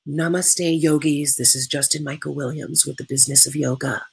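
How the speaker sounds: soft, even-pitched mock yogi greeting; put-on yoga teacher voice. Webinar host mocking fake teacher personas, put-on yoga voice. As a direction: parody a serene yoga teacher introducing themselves, softly. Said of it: "putting on my yoga teacher voice".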